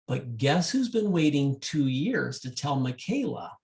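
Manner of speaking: ordinary delivery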